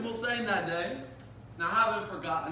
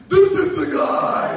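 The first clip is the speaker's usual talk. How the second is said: loud, slow, pointing-out shout; friends shouting; 10 semitones higher, 16 dB louder, slower than usual. Retold or imitated. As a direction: call him out loudly. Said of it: "my friends were like".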